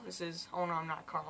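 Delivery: ordinary talk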